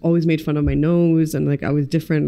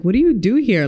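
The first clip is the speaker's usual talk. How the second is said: overwhelmed question; 6 semitones higher, 4 dB louder than usual. Retold or imitated. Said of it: "I was like".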